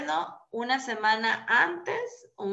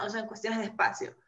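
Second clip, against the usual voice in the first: usual speaking voice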